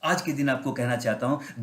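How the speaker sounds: normal talking voice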